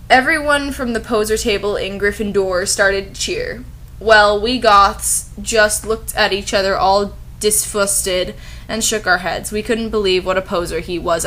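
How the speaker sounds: ordinary talk, nothing marked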